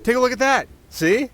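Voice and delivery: high, eager showing off; proud excitement. Acted. Presenting the ice wolves to the listener, high and eager. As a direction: look what I made, delighted.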